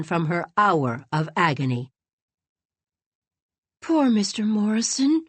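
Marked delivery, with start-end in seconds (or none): 3.8-5.3 a sobbing, higher sigh of pity; girl sobbing with pity for the old man; tearful pity. A narrator's reading. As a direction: pity him through tears. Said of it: "she sobbed".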